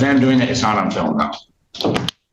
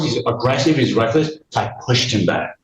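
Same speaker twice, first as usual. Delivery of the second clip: usual speaking voice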